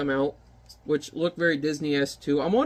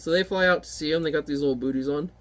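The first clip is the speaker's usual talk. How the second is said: ordinary talk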